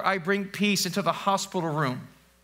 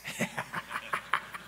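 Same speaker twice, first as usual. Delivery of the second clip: laugh; 10 dB quieter than usual. Real, not acted. Laughs at own joke.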